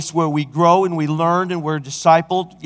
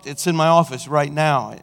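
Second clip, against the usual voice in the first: ordinary talk, nothing marked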